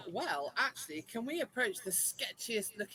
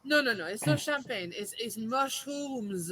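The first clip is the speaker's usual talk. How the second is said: put-on French accent; 2 semitones higher than usual. Customer telling tout it isn't champagne, French accent. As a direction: correct a mishearing in French-accented English. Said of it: "do it in your French accent".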